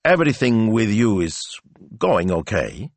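awkward, halting fatherly check-in; hesitant question. A narrator's reading. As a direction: broach it carefully, pausing before the end. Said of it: "he hesitated".

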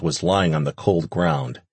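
ordinary delivery

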